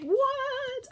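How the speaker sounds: sudden high squeak of surprise; surprised what. Real, not acted. Surprised reaction mid-recipe.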